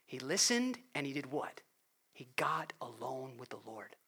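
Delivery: usual speaking voice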